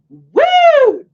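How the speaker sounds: high, loud excited whoop; a sudden high woo. Real, not acted. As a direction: whoop with a burst of energy. Reacting to Arizona heat.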